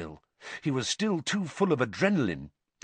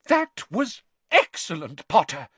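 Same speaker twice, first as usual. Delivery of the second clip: high praise cried out; 7 semitones higher, 4 dB louder than usual. A narrator's reading. McGonagall praising Harry as he lands, cried out. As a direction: let it burst out in praise. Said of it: "cried Professor McGonagall".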